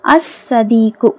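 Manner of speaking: slow "அஸ் சதீக்கு", no L, held double S; silent lam. Shown as a demonstration. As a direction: merge the article into a long S in "அஸ் சதீக்கு". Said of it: "லாம் உச்சரிக்கப்படலை". ("அஸ் சதீக்கு" லாம் இல்லாமல், மெதுவாக)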